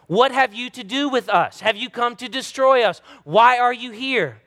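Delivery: a preacher acting out the demon's protest. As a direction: cry out as the frightened demon. Preacher voicing the unclean spirit.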